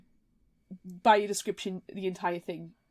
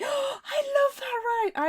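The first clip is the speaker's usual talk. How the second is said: very high, slow squeal; gushing delight; 3 dB louder, slower than usual. Retold or imitated. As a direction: gush over the ride. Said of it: "I was like".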